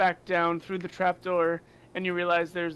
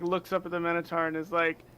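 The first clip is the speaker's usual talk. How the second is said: ordinary talk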